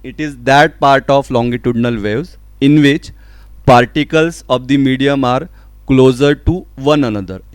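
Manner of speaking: loud, slow, measured reading; emphatic textbook read-out; definition dictation. Real, not acted. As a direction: dictate it to the class, phrase by phrase. Reads out a definition.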